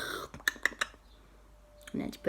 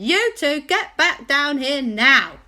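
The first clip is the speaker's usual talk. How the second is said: calling out; 9 semitones higher, 6 dB louder than usual. Acted. Aunt orders boys down from sky.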